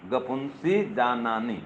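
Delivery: low, drawn-out syllables; slow mnemonic recitation. Shown as a demonstration. Mnemonic recited as completed first step.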